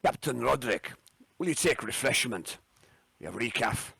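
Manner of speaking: a lightly put-on Scottish accent. The captain offers recaf to guests in a light Scottish accent. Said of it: "in his finest, um, Scottish accent".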